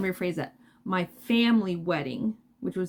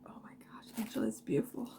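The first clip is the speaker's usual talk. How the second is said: whispered, softer, close to tears; 10 dB quieter, faster than usual. Real, not acted. Thanking the yarn maker Angela, a teary whisper. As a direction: breathe it out, overwhelmed by the gift. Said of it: "I'm gonna cry".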